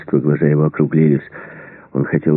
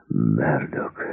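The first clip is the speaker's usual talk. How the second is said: low, quiet, husky utterance; hoarse voice; 5 semitones lower, 8 dB quieter than usual. A narrator's reading. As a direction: rasp the name out softly, slowly menacing. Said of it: "а потом хриплый голос, голос Рэмбо". (хрипло, тихо и низко)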